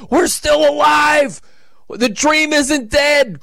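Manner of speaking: high, slow, shouted fan joy; overjoyed fan, nearly in tears; mock tearful joy. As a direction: cry out like a fan saved at the last second. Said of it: "crying tears".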